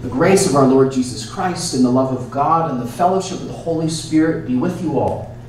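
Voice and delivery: ordinary delivery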